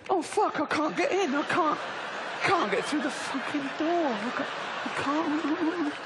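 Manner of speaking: high, straining wail, slowing at the end; hammed-up whining struggle; mock despair. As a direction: wail as if stuck and straining to get through. Acts fat person stuck in doorway.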